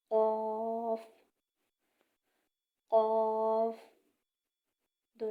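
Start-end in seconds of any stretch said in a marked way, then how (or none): 0.1-3.8 قاف said thick and much louder; heavy qaaf. Shown as a demonstration. Teacher modelling a heavy letter, loud. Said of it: "قاف ہمیشہ موٹا پڑھا جاتا ہے".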